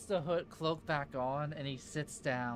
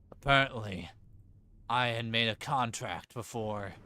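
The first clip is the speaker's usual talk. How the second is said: stranger's voice; 5 semitones lower than usual. Acted. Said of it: "And he asks".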